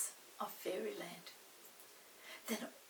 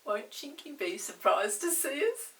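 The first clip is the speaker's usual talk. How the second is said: put-on excited girl; 5 semitones higher, 6 dB louder than usual. Molly speaks, excited.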